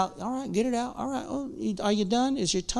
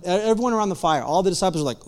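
ordinary delivery

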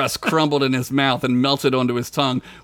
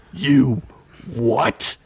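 firmly. Acted. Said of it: "he said firmly".

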